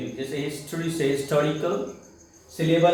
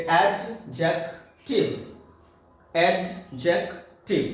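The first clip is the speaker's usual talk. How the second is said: "Adjective" slowly parted as एड, जेक, टिव; three syllables; 2 semitones higher, much slower than usual. Shown as a demonstration. Teacher showing a word of three pieces, syllable by syllable. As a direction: break "adjective" into three with a pause after each syllable. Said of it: "तीन टुकड़े वाले शब्द".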